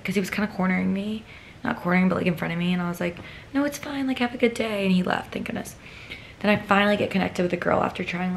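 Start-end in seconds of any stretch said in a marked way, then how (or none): 3.5-4.8 high-pitched, retold polite dismissal; polite brush-off. Woman brushing off a cornering boy, retold high politeness. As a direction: pitch it up to politely send him away.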